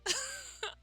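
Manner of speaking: high squealing laugh; high giggle. Real, not acted. Laughing while recapping.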